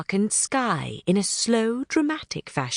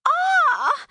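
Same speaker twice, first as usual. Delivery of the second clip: much higher, sharp startled cry; startled pixie yelp; 3 dB louder than usual. Acted. Thistlewit hit by water drops, high startled yelp. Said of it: "Thistlewit yelled".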